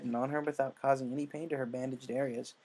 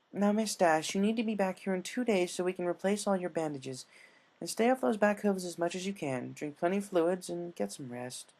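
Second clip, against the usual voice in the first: higher, firm caring nurse; 6 semitones higher than usual. Acted. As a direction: give orders like a kind nurse. Nurse gives instructions.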